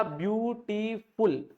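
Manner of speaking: ब्यूटीफुल split slowly into ब्यू, टी, फुल; syllable by syllable. Shown as a demonstration. Teacher counting chin taps for students, ब्यूटीफुल slowly broken into syllables. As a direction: say ब्यूटीफुल in three separate beats. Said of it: "तीन बार touch हुआ".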